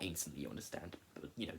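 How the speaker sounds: quiet aside. Real, not acted. Throwaway aside.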